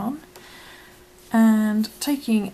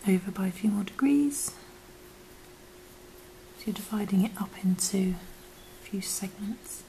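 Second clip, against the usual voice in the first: soft unvoiced whisper; 5 dB quieter than usual. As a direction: whisper it gently. Whispered craft tutorial.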